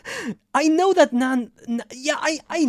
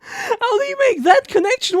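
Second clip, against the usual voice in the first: high, strained disbelieving question; half-laughing, half-wailing in disbelief; can't believe the joke, pitched well up; incredulous; 11 semitones higher, 6 dB louder than usual. Real, not acted.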